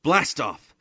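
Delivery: loud, high countdown call; launch shout. A narrator's reading. Father launches the rocket, shouting.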